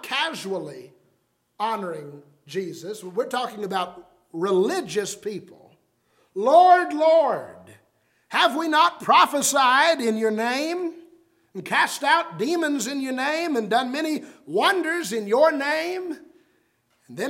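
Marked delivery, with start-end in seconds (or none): 6.3-16.2 loud, higher pleading protest; emphatic pleading. Retold or imitated. Religious people protesting to Jesus at judgment, loud plea. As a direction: cry out to the Lord to be recognized. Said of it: "is an emphatic way".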